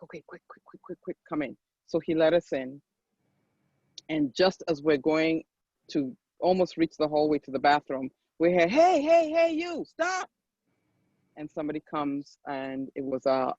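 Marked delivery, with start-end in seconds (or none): 0.0-1.6 very soft, hushed and hurried; imitating a hushed, conspiratorial go-ahead; retold furtive urging. Retold guard furtively letting them in. 8.7-10.3 very high-pitched mock yell; high, sustained call from down a hallway; retold calling out. Retold shout halting the family.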